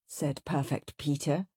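ordinary delivery, nothing marked

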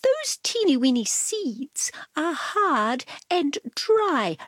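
prissy cat explaining; Cat's voice. A narrator's reading. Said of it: "announced Cat".

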